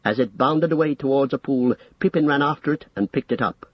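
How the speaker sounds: ordinary delivery, nothing marked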